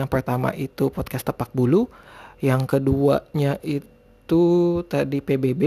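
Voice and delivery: ordinary talk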